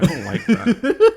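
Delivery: laughing, high and slow. Real, not acted. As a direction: squeal through a laugh. Teasing the flexing.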